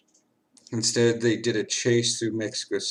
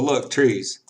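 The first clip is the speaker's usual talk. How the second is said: ordinary talk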